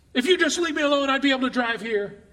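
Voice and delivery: fed up, pleading with the car to hush; mock exasperation.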